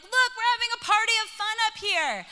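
gleeful mock boasting; party excitement. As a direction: sell the party to a sulking kid. Mom advertising the fun upstairs.

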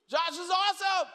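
a proud dad shouting across the water; excited shout. Retold or imitated.

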